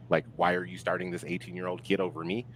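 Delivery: usual speaking voice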